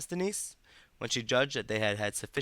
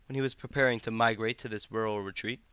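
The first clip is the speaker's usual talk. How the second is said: ordinary delivery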